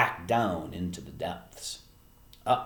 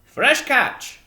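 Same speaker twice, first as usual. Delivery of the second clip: loud, high fisherman's reply; jovial sailor calling out; calling back; 12 dB louder than usual. Acted. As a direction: holler it back across the street. Fisherman calls back to woman.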